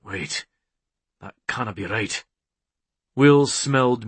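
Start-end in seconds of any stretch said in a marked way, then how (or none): 0.0-2.2 low, soft, lightly voiced puzzlement; puzzled muttering. A narrator's reading. Man sniffing for his mate, muttering in doubt.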